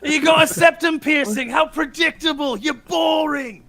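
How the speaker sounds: loud, high, drawn-out Aussie mockery; Australian impression. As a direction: jeer slowly in a hammed-up Australian accent. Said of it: "you can nail that impression right away".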